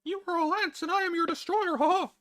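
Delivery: very high, loud falsetto proclamation; mock-menacing villain in a squeaky falsetto; vocal range show-off. Shown as a demonstration. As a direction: declare doom at the top of your range. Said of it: "I have excellent range".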